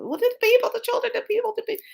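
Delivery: high frantic cry fading off; panicked high pleading that fades; high upset pleading; retold screaming. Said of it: "I was screaming".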